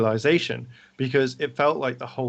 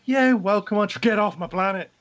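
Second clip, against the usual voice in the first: mock reversal; 8 semitones higher, 4 dB louder than usual. Leader's sudden reversal, mocked.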